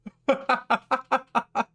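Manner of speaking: high mocking villain laugh. Villain laughing off Bakugo's threat, mocking.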